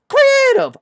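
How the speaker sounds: very high, loud squeaked answer; mock millennial. Host mocking millennial fan's answer, high squeak. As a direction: squeak it like a silly kid. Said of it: "one of these millennials".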